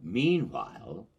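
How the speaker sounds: ordinary delivery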